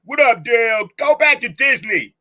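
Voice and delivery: high, loud quoted holler; mock shout. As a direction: shout it out like a hammed-up movie line. Acting out a mocked movie line.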